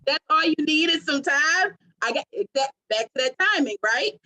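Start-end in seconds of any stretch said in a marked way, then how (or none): 0.1-1.8 mock incredulous.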